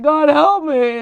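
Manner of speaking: high, loud mock wail to God; desperate plea. Woman imitating her past prayers for help, desperate loud plea.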